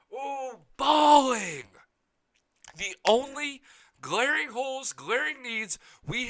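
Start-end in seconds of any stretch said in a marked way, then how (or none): 0.1-1.6 slow, loud hyped shout; hyped fan hollering the word; drawn-out shout. Real, not acted. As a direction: stretch it out and yell it. Hyped rant about the defense.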